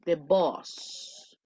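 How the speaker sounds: the boss with a rounded o, de bos, voice dropping low. Shown as a demonstration. Teacher contrasts boss with bus, rounded o. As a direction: sound out boss, the word for jefe, rounded o. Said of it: "¿logran identificar un poquito la diferencia?".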